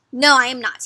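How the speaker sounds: loud grumpy denial; mildly grumpy storybook bear. Acted. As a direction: refuse firmly, acting annoyed.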